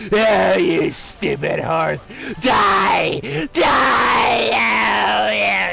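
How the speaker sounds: furious yelling. Real, not acted. Gamer screams at the horse on screen, furious. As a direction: roar at the stupid horse.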